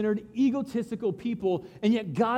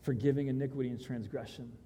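reverent quote; 6 semitones lower, 9 dB quieter than usual. Retold or imitated. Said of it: "that's when he says".

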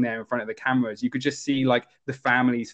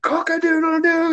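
very high mock cock-crow, going nuts; imitated frantic wake-up crowing; manic rooster crow; 4 dB louder than usual. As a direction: crow wildly like a rooster waking someone. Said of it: "He's just, like, going nuts".

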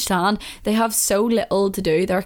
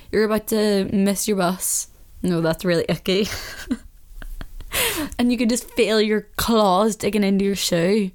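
usual speaking voice

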